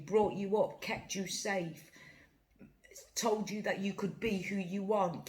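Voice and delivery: ordinary talk, nothing marked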